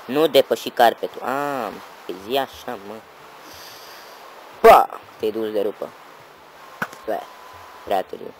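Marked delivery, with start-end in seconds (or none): 4.6-5.0 loud, high burst; sudden high shout; shouted send-off. Real, not acted. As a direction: yell a mocking goodbye. Mockingly waving off falling target.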